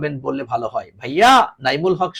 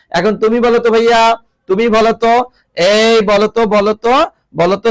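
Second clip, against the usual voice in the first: louder, higher urging "বলো তো"; 5 semitones higher, 6 dB louder than usual. Real, not acted. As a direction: push the class to answer, louder. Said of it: "teacher urging students to answer". (ছাত্রদের উত্তর দিতে জোরে তাগাদা)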